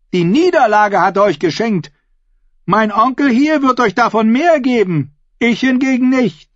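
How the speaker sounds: high-pitched, forceful oratory to a hall; defiant female claimant. Acted. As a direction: challenge the crowd like a contender for the crown. Asha addresses the assembly.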